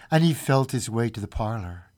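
ordinary delivery

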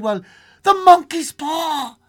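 high, loud, drawn-out cry; wild cry. A narrator's reading. Wife crying out about the monkey's paw, wild and high. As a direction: cry it out frantically, stretching the words. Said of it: "she cried".

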